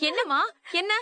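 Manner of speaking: put-on high, anxious calling of the child's name; mother alarmed to find Sudar crying. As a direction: rush in worried about her crying child. Mother finds daughter crying.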